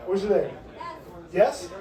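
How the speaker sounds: ordinary talk, nothing marked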